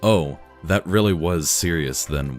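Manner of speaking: plain reading voice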